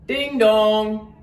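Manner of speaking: loud, high ringing ding-dong; doorbell imitation. A narrator's reading. Narrator sounding Santa's doorbell, loud ringing call.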